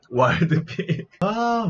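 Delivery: normal talking voice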